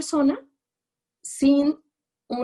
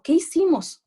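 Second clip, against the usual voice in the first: put-on high, loud scared-child question; mock frightened question; 8 semitones higher, 6 dB louder than usual. Lecturer acting out the trembling girl, high and loud. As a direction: ask it like a frightened little girl. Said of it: "y yo temblando".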